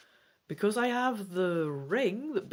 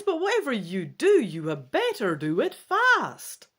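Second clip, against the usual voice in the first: urgent cry; 6 semitones higher, 6 dB louder than usual. A narrator's reading. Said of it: "she cries".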